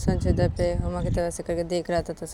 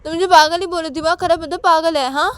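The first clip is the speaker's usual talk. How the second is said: high, loud protest; arguing Uma; 10 semitones higher, 9 dB louder than usual. Acted. Uma arguing with Sanju over the insult, high insistence. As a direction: insist in a raised voice.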